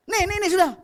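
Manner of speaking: loud, high and impatient, shoving it at them; just take it and leave me alone; annoyed giver thrusting money away; grudging handover. Retold or imitated. Grudging giver shoves it over.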